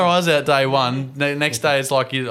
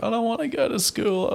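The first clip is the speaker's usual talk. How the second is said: put-on high whining child voice, much higher; whiny kid; 12 semitones higher, 3 dB quieter than usual. Dad imitating a daughter who won't go in, high whine. Imagined child dreading school.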